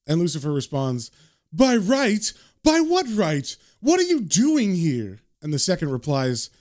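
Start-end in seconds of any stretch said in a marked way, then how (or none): 1.5-5.2 high-pitched, incredulous devil; affronted, pitch leaping up; indignant outburst. A narrator's reading. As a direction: throw the questions back in outrage. Lucifer outraged at the second.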